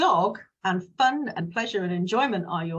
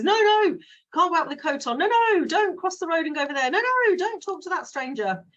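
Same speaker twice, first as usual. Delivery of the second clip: high, loud nagging parent voice; scolding parent; 9 semitones higher, 4 dB louder than usual. Acted. Parent forbidding a child everything, high scolding nag. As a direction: wag a finger at a kid, no no no.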